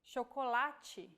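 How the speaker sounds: chocolate with CH said as SH, shoh-koh-LAH-chee; Portuguese chocolate, CH as SH. Shown as a demonstration. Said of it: "we have the sound".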